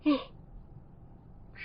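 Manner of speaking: long airy yawn; wide open-mouthed yawn; big real yawn. Real, not acted. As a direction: let out a full yawn. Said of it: "I always yawn when I'm podcasting".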